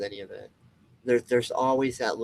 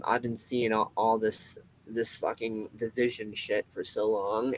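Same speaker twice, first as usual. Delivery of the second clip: ordinary talk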